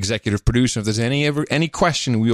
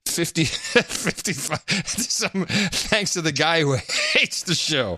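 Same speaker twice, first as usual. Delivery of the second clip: laughing; 4 semitones higher, 4 dB quieter, slower than usual. Real, not acted. Laughing through birthday wish.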